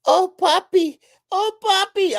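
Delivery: high, loud mock moaning woman's voice; hammed-up falsetto moan of a lover; a woman crying out Poppy, hammed-up. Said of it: "she was like".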